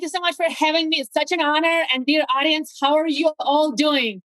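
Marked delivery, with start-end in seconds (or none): none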